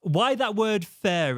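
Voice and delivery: slow, with "fair" carefully opened. Shown as a demonstration. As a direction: slowly say "Why that word fair" avoiding "fur". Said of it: "very conscious of trying to say fair".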